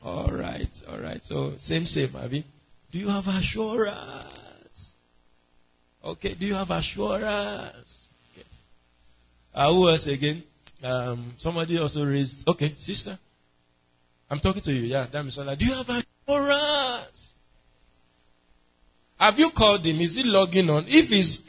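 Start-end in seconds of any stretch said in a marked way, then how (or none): 2.9-4.9 soft, slow, stretched-out question; drawn-out questioning. Real, not acted. Preacher quizzing a member on assurance, drawn-out questioning. 6.3-8.1 soft, slow, stretched-out question; drawn-out questioning. Real, not acted. Preacher quizzing a member on assurance, drawn-out questioning. 15.6-17.3 higher, softer, long-held last word. Real, not acted. Same question put to Damisola.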